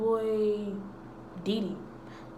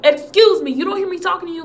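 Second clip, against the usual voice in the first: teacher yelling; 7 semitones higher, 10 dB louder, faster than usual. Retold or imitated.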